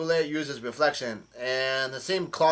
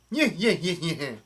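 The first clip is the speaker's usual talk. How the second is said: nyeh-nyeh laugh, pitched up at first; imitated villain cackle; 3 semitones higher than usual. Retelling Brulé's attack in a story, mock villain cackle. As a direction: mimic a cartoon villain's chuckle.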